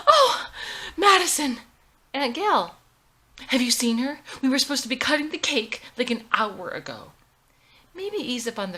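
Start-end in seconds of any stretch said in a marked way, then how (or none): 0.1-1.6 gasp, then a loud high cry of the name; gasping surprise. Acted. Aunt storming into the bathroom, loud startled gasp. 2.1-7.1 loud, fast, high and urgent; frantic. Acted. Mother searching the bathroom for the bride, loud frantic rush.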